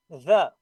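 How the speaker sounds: The with pushed air through bitten tongue; The with friction and loud; voiced th. Shown as a demonstration. Teacher repeating the correct th. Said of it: "Push the air".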